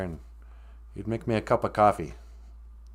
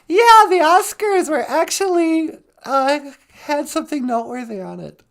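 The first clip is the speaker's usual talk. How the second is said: high, louder excited gossip; eager gossip; 8 dB louder than usual. Real, not acted. Cohost excited to discuss the Oscars slap.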